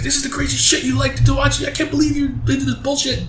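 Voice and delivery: high-pitched mock outrage; outraged scolding. Wife confronting the man over his porn, outraged.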